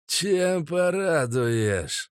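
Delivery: slow, high, cracked boss voice. Acted. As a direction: drawl it like a menacing old boss. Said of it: "Натреснуто высоким громким голосом".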